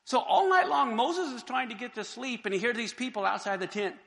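normal talking voice

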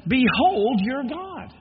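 loud, very slow proclamation; proclaiming. Acted. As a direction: call it out like a herald. Proclaims the verse climax.

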